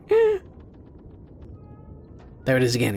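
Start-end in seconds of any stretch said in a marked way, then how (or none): 0.1-0.4 short, very high squeak; imitated squeaky noise. Player imitating an odd squeak he heard, high squeak. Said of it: "There was a squeaky noise".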